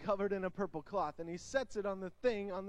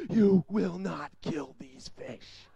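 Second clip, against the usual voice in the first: put-on slow, louder threat from the girl; menacing girl; 3 semitones lower, 8 dB louder, much slower than usual. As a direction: growl a slow warning. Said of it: "and she goes".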